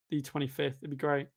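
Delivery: ordinary delivery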